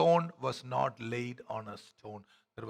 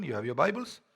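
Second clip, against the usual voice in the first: ordinary talk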